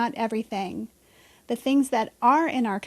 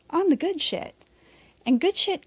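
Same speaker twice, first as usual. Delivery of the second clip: higher cheeky emphasis; 5 semitones higher than usual. Real, not acted. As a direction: relish the swear playfully.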